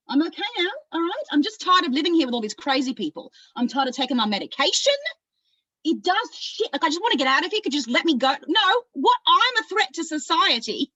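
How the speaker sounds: high, loud, worked-up outburst; frantic Australian character, pitch climbing high; frantic protest. Acted. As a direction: build from insisting you're fine to indignant cries. Acted patient pleading to be let go.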